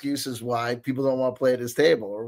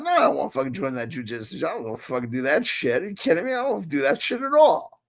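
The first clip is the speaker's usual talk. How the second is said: mock indignant refusal; 6 semitones higher, faster than usual. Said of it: "I'm like".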